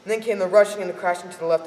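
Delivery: ordinary delivery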